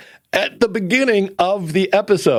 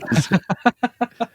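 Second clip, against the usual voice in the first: high staccato chuckle; light, rapid laughter after a rant; 9 semitones higher than usual. Real, not acted. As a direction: let out a quick run of high giggles. Laughs at end of own rant.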